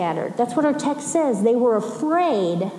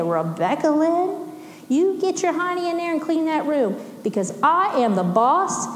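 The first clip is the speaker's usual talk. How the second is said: higher-pitched scolding, imitating mom; frustrated mom; 4 semitones higher than usual. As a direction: play a fed-up mother laying down the law. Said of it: "my mom would get so frustrated with us".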